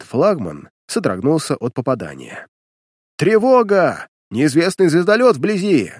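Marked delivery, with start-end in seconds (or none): 3.2-5.9 high, loud alarm call; alarm shout. Acted. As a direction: shout the alert from the bridge. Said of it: "Донёсся сигнал с мостика". (громкий сигнал тревоги)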